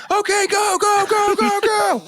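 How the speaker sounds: high barked go go go; military squad leader. Retold or imitated. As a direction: urge the squad out the plane. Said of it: "he goes full into character".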